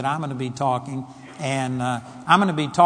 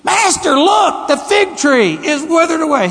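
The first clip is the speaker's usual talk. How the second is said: high, loud amazed cry; shocked exclamation; 11 semitones higher, 6 dB louder than usual. Shown as a demonstration. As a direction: blurt it out in disbelief. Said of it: "what he was doing was".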